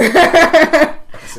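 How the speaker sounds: loud real laugh; hearty laugh; burst of laughter. Real, not acted. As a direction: laugh out at your own joke. Laughs at own joke.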